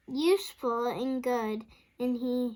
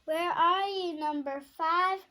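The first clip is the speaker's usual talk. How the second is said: high, loud calling voice; 6 semitones higher, 4 dB louder than usual. A narrator's reading. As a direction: call out for the lost duckling.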